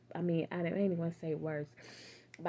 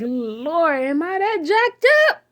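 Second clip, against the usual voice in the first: loud, high incredulous plea; stunned disbelief; 9 semitones higher, 14 dB louder, slower than usual. Retold or imitated. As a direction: plead in laughing disbelief.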